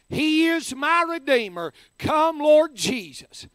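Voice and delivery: full-force preaching. Acted. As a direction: preach it out with fervour to the crowd. Preacher tells a deathbed story.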